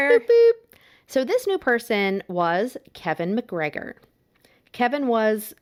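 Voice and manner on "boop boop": high, loud sing-song boops; playful boop. Real, not acted. Podcaster chirping at her cohost's spoiler, high and loud. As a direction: bounce it out like a silly sound effect.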